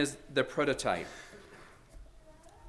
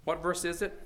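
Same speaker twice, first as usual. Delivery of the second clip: ordinary talk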